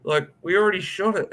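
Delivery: lightly voiced wife. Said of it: "came out and goes".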